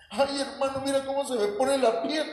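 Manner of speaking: voz aguda de creyente maravillado; asombro imitado. Retold or imitated. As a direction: exagera el asombro, muy agudo. Mocking goosebump-feeling believer. (emoción fingida, voz aguda)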